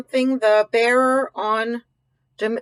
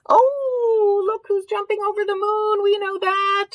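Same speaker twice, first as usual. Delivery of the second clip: high, delighted exclamation; much higher and thrilled; excited; 11 semitones higher, 3 dB louder than usual. Real, not acted. Delighted spotting cow over moon.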